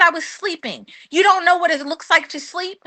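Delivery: high-pitched indignant retort; indignant protest. Retold or imitated. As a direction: scoff at him for not knowing sleep. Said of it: "I was so mad".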